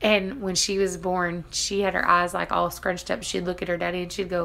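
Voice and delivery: ordinary talk, nothing marked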